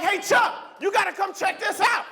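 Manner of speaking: calling out. Retold or imitated. Calling friend upstairs.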